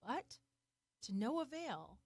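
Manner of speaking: ordinary delivery, nothing marked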